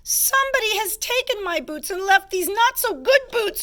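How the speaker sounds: high-pitched storybook wail; squeaky cub wailing over a loss; wailing baby bear. A narrator's reading. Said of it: "she wailed".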